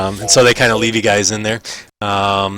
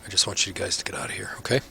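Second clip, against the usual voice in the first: indifferent guard; 9 dB quieter, faster than usual. Acted. GM voices guard Drolin.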